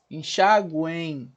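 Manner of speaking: very slow "Enxaguem", ending in a gliding "u-ein"; triphthong demo. Shown as a demonstration. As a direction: stretch "Enxaguem", sounding U and final I. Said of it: "sonoricamente falando, um I". ("Enxaguem" bem devagar, soando "güein")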